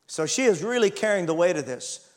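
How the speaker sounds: usual speaking voice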